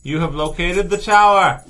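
slow, grand room reveal; dramatic announcement. Acted. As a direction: announce it like a spooky host.

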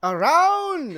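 very high, loud drawn-out cry; exuberant ride attendant. Acted. Attendant answering riders before starting the ride, high exuberant shout. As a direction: sing it out big as the ride launches.

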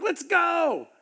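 high, eager burst; impatient and ready to charge. Retold or imitated. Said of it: "I'm like".

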